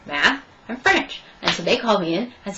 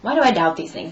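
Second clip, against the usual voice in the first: normal talking voice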